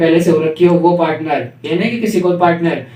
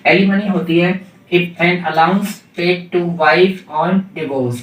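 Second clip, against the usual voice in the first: slow dictation; much slower than usual. Shown as a demonstration. Teacher dictating a definition.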